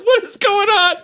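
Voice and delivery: very high, laughing squeal of bafflement; incredulous question through laughter; laughing disbelief. Retold or imitated. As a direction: crack up while asking it, voice shooting way up. Retells baffled reaction while laughing.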